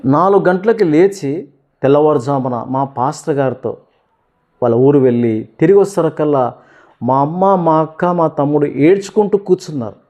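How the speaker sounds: normal talking voice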